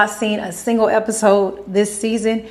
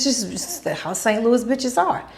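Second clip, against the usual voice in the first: normal talking voice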